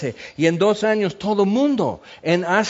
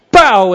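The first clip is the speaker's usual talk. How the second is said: a sudden loud pau, like a punch landing; loud impact noise; 3 semitones higher, 12 dB louder than usual. Acted. Preacher imitating the gospel striking a drinker, loud impact. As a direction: smack the word out like a blow.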